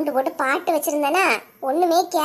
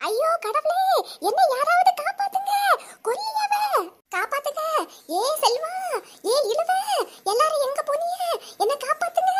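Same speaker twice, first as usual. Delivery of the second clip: much higher, desperate pleading cries; crying for help; 9 semitones higher than usual. Acted. Wife running from husband calls neighbours, desperate cries for help. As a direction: beg the neighbours to save you.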